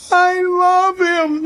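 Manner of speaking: high slow blubbering; mock sobbing child. Father mimics his son crying over the lost dog, slow high mock sob. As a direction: sob it like a heartbroken kid.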